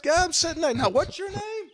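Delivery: high eager phone chatter; high, excited call to family; excited lady on phone. Retold or imitated. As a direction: play the lady calling her son-in-law.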